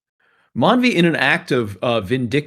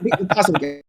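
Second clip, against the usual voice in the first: a clumsy attempt at a New Zealand accent; bad New Zealand accent meant as South African; 4 semitones higher than usual. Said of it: "I'm doing a horrible New Zealand accent, and I'm calling it South African".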